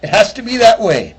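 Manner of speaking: high, loud Irish lilt; Irish leprechaun. Retold or imitated. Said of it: "He goes".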